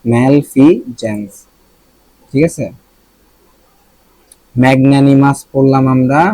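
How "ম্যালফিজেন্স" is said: ম্যালফিজেন্স slow and split, with "j" in "jens"; pronunciation demo. Shown as a demonstration. Teacher asking then answering, ম্যালফিজেন্স sounded out slowly as "mal-fi-jens". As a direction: stretch out ম্যালফিজেন্স, lifting on "fi". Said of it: "উচ্চারণটা কি?".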